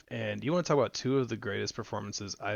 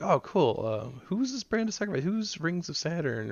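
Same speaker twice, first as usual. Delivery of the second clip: mock puzzled newcomer; 7 semitones higher than usual. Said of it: "they'll be like".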